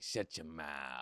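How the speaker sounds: slow, low, drawn-out mock retort. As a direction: play it low and dragged out. Acted retort to paying sponsors.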